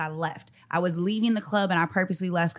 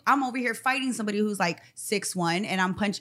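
ordinary talk

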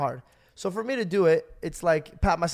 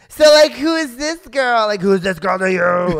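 loud interrogation; 6 semitones higher, 11 dB louder than usual. Retold or imitated. Friend grilling him before the mom, loud mock demand. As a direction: imitate a friend blaring an awkward question.

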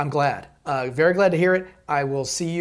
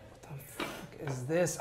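ordinary delivery

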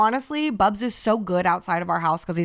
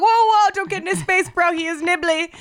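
high, louder urgent warning; 10 semitones higher, 4 dB louder than usual. Retold or imitated. Owner warning the fan off, high and urgent. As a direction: stop her quickly before she reaches in. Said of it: "I was like".

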